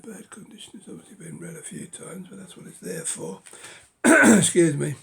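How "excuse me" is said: ordinary talk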